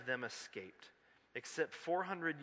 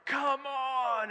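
frustrated shout; 12 semitones higher, 8 dB louder than usual. Retold or imitated. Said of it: "and we're like".

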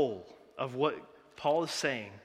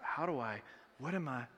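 nervous; 8 dB quieter than usual. Retold or imitated. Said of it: "the people on that hill getting nervous".

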